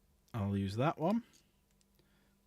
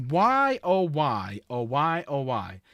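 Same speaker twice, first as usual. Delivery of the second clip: exasperated lament; 7 dB louder, slower than usual. Real, not acted. Hobbyist ranting to viewers about intercessor models, exasperated lament. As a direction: plead to the heavens in exasperation.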